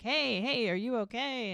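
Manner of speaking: retold worried "are you okay"; concerned check-in. Acted husband checking on struggling wife.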